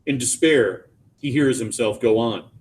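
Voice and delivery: ordinary delivery, nothing marked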